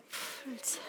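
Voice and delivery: soft unvoiced whisper. Shown as a demonstration. Lecturer demonstrating a calm resolve, whispered. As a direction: breathe it out in a whisper.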